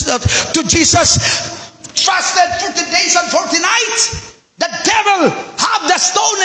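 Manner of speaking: high, urgent sermon voice; impassioned pulpit delivery; full-force preaching. Acted. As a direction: drive the point home to the church.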